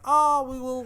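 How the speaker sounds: high, breezy mock Hank, carefree; lightly hammed-up imitation of a smug hero. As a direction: toss it off like Hank shrugging off his problems. Mocking Hank's easy-going reply.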